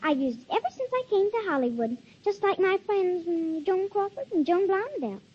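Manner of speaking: ordinary talk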